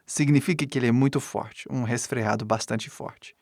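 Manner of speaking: ordinary talk, nothing marked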